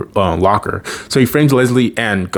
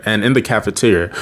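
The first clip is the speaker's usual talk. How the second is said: usual speaking voice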